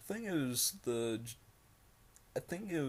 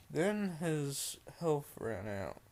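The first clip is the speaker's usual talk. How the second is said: plain reading voice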